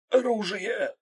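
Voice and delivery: strained, high-pitched hoarse cry; hoarse utterance. A narrator's reading. Krot with a knife at his throat, hoarse strained cry. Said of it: "хрипло произнёс Крот".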